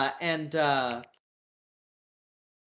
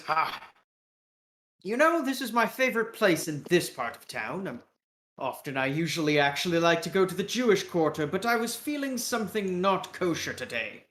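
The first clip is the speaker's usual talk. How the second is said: chatty British caricature; British accent. Acted. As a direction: make small talk in a British accent. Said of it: "with a British accent".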